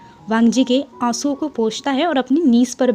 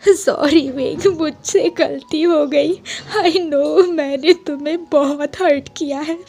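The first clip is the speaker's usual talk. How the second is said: put-on higher, slow childlike sobbing plea; crying like a child; 3 semitones higher than usual. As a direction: sob and beg like a small child. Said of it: "छोटे बच्चे की तरह रोने लगता है". (छोटे बच्चे की तरह रोते हुए)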